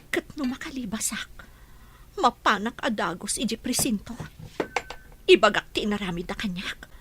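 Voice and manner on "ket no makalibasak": breathy hushed whisper. Acted. Prisoner whispering his escape plan to himself. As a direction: keep it secret, barely voiced.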